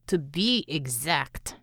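slow words through gritted teeth; between clenched teeth. Acted. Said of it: "Natalia said between clenched teeth".

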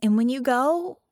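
put-on a child insisting on coming along; determined young girl; earnest little girl. A narrator's reading. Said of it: "Penny insists".

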